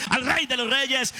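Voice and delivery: ordinary talk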